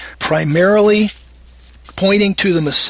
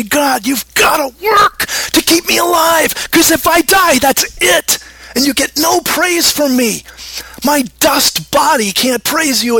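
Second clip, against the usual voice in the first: high, shouted plea to God; desperate shouted prayer; 6 semitones higher than usual. Acted.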